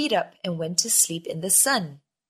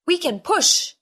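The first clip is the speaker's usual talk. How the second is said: high, loud call; a sailor shouting a plan; shouted; 8 semitones higher, 4 dB louder than usual. A narrator's reading. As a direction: call out an idea to the crew. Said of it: "shouted a sailor".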